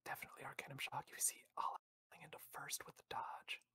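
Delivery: whispering. Shown as a demonstration. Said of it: "A S M R race here".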